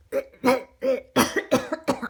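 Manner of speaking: choking gag from foul breath; gagging cough. Acted. Wolf choking on the witch's breath, gagging cough. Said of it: "gagging cough sound".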